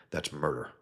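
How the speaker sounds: ordinary delivery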